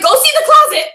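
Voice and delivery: can't stop laughing while quoting. Real, not acted. Realtor sends them past the bed, laughing hard. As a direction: quote squeezed out through laughter, high and loud.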